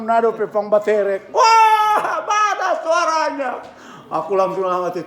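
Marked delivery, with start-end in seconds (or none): 1.3-3.5 high laughing jeer; much higher, louder mock shout with laughter; mocking crowd yell. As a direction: heckle like the crowd outside. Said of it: "yang di luar langsung teriak".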